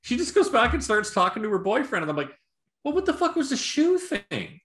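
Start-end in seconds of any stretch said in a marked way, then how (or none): 2.8-4.6 high-pitched incredulous complaint; retold bafflement. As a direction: demand it in mock bewilderment. Retelling his baffled reaction.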